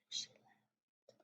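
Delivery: hushed, breath-only aside; whisper. Acted. Reader tells children mama may be gone, whispered. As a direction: whisper it as dreadful news.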